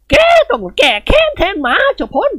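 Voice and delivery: put-on high, commanding old lady's voice; an elderly matron barking an order at her son. Khun Ying Wad commanding her son to avenge her dog, high and bossy.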